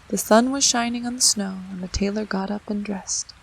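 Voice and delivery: ordinary delivery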